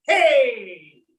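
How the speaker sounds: a loud cheering hey; rousing hey; shout hey. Shown as a demonstration. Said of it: "take it up and say".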